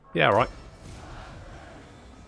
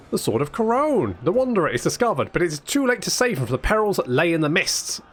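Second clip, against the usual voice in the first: higher, declaimed quest-text reading; story narration; 4 semitones higher than usual. Acted. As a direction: announce it like a chivalric legend.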